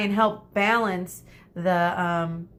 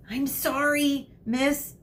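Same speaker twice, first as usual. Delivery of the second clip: put-on timid girl voice; 7 semitones higher, slower than usual. Medusa's timid reply to teacher.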